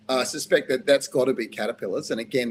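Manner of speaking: ordinary talk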